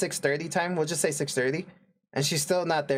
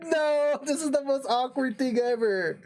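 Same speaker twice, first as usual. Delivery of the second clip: much higher, half laughing and half crying; laughing despair; 10 semitones higher, slower than usual. Retold or imitated. The narrator dreading the awkward date with her brother, high laughing whine. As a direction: whine it out through laughter, mortified.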